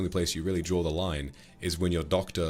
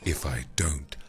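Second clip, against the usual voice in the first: very slow, low, menacing voice; severe food critic; 7 semitones lower, much slower than usual. Acted. Streamer playing the film's food critic, slow and ominous. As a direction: savour each word with long ominous pauses.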